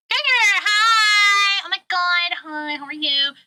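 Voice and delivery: high, loud hammed-up hello; mock-excited squeal that settles down; gushing greeting. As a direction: greet him with over-the-top excitement.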